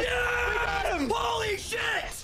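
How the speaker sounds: high, slow triumphant yell; hyped gamer bro. Real, not acted. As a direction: scream it after a clutch kill. Teammate celebrates the kill.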